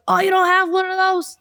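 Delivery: put-on high, surprised New York-accented quote. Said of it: "your New York accent was pretty good".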